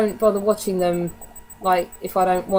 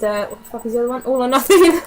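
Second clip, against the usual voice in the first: normal talking voice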